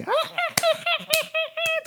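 long, high cackling laugh; hammed-up falsetto hoot of laughter; mock loud cackle. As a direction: imitate a gaggle of chattering old ladies cackling. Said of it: "大きい声で話し".

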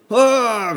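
loud high mock scream; comic shriek of alarm; panicked scream. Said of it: "そういう声を出します".

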